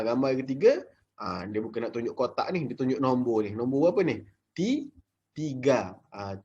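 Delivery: ordinary talk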